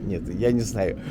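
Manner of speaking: usual speaking voice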